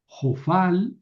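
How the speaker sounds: normal talking voice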